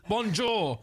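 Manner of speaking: British-accented French. Shown as a demonstration. Letting the French shop know they're British, British accent. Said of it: "let them know that I'm British".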